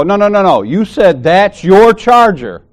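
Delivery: slow, pointed insistence; insistent retold protest. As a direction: hammer home that she said it.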